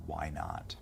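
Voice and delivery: hushed, unvoiced whisper to the audience; conspiratorial whisper. Host whispers to listeners about annexing Canada, conspiratorial whisper. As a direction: lean in and whisper it like a secret.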